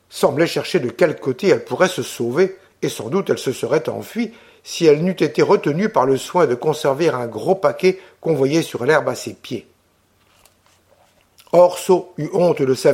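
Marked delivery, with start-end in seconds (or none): none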